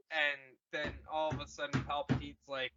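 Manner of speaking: usual speaking voice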